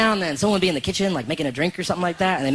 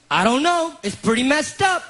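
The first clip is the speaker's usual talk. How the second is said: Darwish; 7 semitones higher than usual. Retold or imitated. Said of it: "And he goes".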